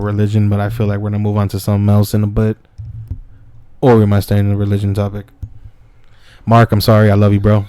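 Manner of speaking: loud, booming shout-outs; projecting loudly to the camera. Real, not acted.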